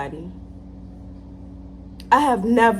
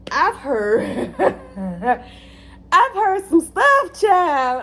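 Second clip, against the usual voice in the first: high, loud laughing drawl; high amused exclamation; laughing disbelief; 7 semitones higher, 4 dB louder, much slower than usual. Real, not acted.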